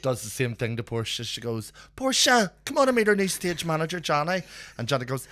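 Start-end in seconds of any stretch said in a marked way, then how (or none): 1.9-4.4 high, loud mimicked summons; imitated calling over. Performer calling Portia to meet someone, mimicked call. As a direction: call brightly to a colleague, pitched up.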